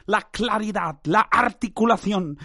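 normal talking voice

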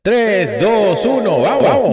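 high, loud hyped countdown; countdown in Spanish. Real, not acted. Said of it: "No, puta, no, en español".

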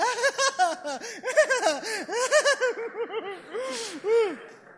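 high, loud bursts of laughter; hammed-up laughing outburst; mock laughing fit. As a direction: cackle long and loud. Acts out what you will say.

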